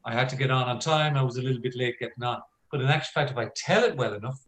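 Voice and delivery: normal talking voice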